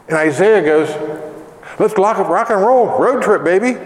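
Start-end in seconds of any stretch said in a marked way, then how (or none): 1.8-3.8 high-pitched, playful gung-ho cheer; hammed-up eagerness for a road trip; eager excitement. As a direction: act raring to go, pitch up. Acts Isaiah eager to go.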